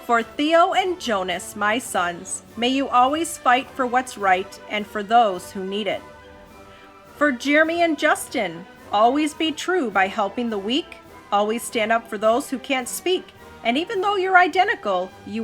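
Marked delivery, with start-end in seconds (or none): none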